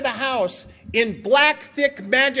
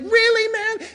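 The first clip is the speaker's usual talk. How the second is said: incredulous; 10 semitones higher, slower than usual. Retold or imitated. Dad incredulous in criminal court.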